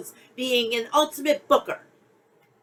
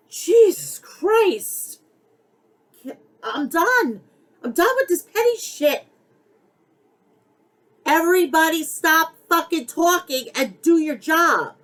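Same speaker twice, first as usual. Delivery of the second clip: exasperated outburst; 5 semitones higher than usual. Real, not acted. Fed-up fan ranting at the promotions, exasperated outburst. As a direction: throw up your hands and vent, voice climbing. Fed-up rant at wrestling feud.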